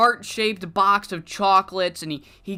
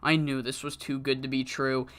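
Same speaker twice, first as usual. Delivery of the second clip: low, soft, crushed murmur into the mic; somber tone; 7 semitones lower, 6 dB quieter than usual. Retold or imitated. Heartbroken wrestler to the arena crowd, somber and low. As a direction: let the joy drain out, heartbroken. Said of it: "in a very somber tone".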